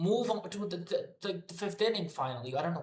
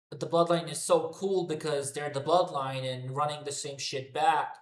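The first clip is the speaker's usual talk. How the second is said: ordinary delivery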